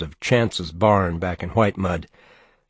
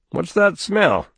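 higher, suspicious question; 10 semitones higher than usual. A narrator's reading. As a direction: sniff it out suspiciously. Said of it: "he said suspiciously".

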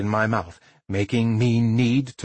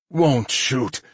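contrite; 2 semitones higher than usual. Acted. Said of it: "he promised, way more contrite".

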